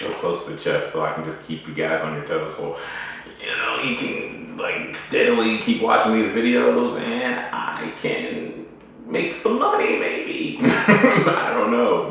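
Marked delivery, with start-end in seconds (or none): 3.4-12.1 high, drawn-out jokey pitch breaking into laughter; playful joking. Real, not acted. Vlogger half-joking to viewers about making some money, playful. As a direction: stretch it out high, then laugh it off.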